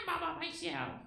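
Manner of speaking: high, soft little puppet voice, sad; a lonely little Muppet feeling sorry for itself; Elmo voice. Acted. Elmo alone on the court, lonely high puppet voice.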